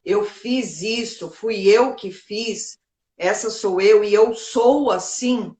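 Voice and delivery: slow, deliberate statement to oneself; measured, weighty self-affirmation; self-acceptance. Acted. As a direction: own it word by word, unhurried. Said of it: "você olha e você fala".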